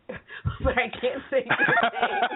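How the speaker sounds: high, slowed words breaking into laughter; helpless real laugh through the words. Real, not acted. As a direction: crack up while admitting it. Laughs at failing the name.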